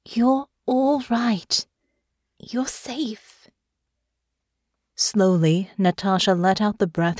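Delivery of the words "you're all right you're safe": slow, higher-pitched reassurance; gentle, drawn-out comfort; soft and soothing. A narrator's reading. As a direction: settle someone down tenderly, fading softer. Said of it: "soft and soothing now".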